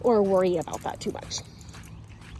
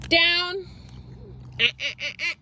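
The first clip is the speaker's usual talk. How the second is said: ordinary delivery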